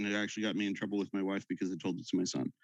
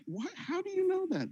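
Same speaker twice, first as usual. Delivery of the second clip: mock surprise. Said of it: "There's a little bit of surprise".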